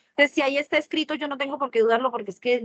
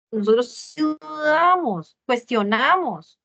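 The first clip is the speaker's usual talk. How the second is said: slow, weighty, louder; slow emphatic; 3 dB louder, much slower than usual. Acted. Preacher hammers home to congregation that we doubt. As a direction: lean on every syllable slowly.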